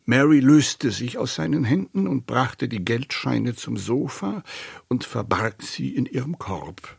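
ordinary delivery

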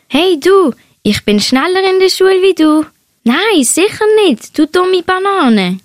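high, lively mock squabble; teasing childlike banter. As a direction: call over and bicker playfully. Friend calls out, teasing exchange in story.

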